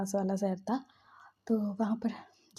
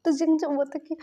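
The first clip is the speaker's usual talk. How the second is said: ordinary talk, nothing marked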